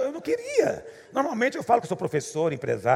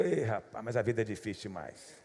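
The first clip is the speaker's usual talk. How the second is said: mock weary stranger; 8 semitones lower, 9 dB quieter than usual. Stranger sighing at the airport.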